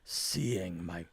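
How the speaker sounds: low, hushed character voice; subdued, absorbed seer. Acted. Game master voices Viraz explaining his vision.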